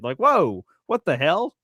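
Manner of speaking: ordinary delivery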